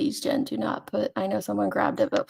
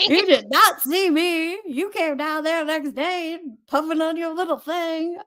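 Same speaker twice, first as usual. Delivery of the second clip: bad mock Louisiana accent, pitched up; a clumsy Louisiana twang, higher than usual; 7 semitones higher than usual. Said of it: "[name]' accent's in Louisiana now".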